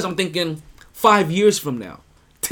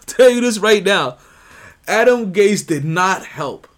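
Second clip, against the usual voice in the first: louder, slow and deliberate; emphatic; 4 semitones higher, 7 dB louder than usual. Real, not acted. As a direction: lay it down word by word. Emphatic follow-up on Gase.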